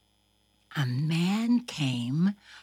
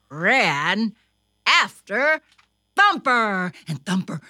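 plain reading voice

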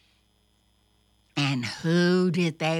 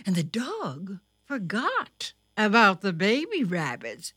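plain reading voice

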